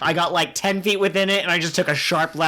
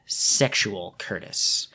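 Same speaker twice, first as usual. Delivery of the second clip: over enunciating; 6 semitones lower than usual. Retold or imitated. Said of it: "I don't enunciate worth crap".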